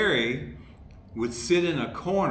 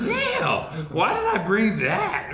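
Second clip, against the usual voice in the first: much higher, loud mock groan at yourself; mock dismay; 8 semitones higher, 6 dB louder than usual. Fired writer carrying his box out, mock exasperated. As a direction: whine it like you're carrying a heavy box. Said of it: "You know".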